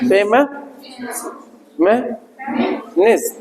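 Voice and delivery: फेमिनिस्ट broken as फेम- में- निस-, each syllable alone; split into syllables. Shown as a demonstration. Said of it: "फेमिनिस्ट". (शब्दांश अलग-अलग)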